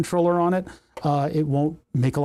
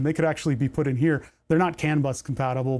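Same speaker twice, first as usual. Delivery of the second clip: ordinary talk, nothing marked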